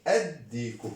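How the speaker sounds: normal talking voice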